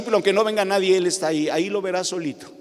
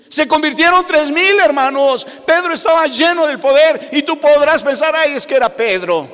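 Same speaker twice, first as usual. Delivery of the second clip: higher, louder triumphant proclamation; fiery preaching; 10 semitones higher, 9 dB louder than usual. Acted. Pastor proclaiming the conversions to the brothers, triumphant preaching. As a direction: proclaim it to the room.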